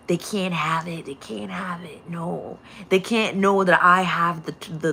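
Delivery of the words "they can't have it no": much softer, hushed and secretive. Acted. Hoarder keeping the toy secret, in a hushed voice. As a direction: say it like a secret kept from them.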